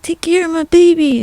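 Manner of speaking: high mock dying plea. Dying man begging Sandra Bullock, high mock plea. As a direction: pitch up as if begging with last breath. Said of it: "He's like".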